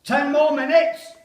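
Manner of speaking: far-off officer's shout; shouted countdown. Acted. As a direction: call it out like an officer over the guns. Officer calling countdown in trench.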